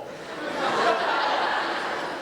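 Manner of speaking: a short real laugh at himself. Real, not acted. Speaker laughing at his own basketball blunder, real laugh.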